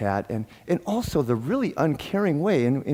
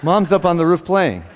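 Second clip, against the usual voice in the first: louder, higher, laughing through the punchline; 6 semitones higher, 9 dB louder than usual. Retold or imitated. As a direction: land the joke, cracking up. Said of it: "Ed says".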